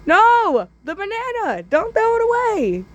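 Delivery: very high squeaky shout; hammed-up high banana shout; banana cry. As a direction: shriek like a tiny banana. Banana pleads.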